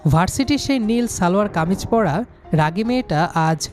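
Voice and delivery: ordinary delivery, nothing marked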